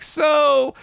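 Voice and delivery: high-pitched, wheedling audition request; imitated casting director. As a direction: pitch it way up, hesitant mock-polite casting request. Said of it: "going into auditions of like".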